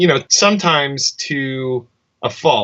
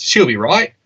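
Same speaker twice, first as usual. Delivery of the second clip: put-on higher-pitched Australian accent; 4 semitones higher than usual. Explaining what Aussies just say, Australian accent. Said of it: "you nailed that accent".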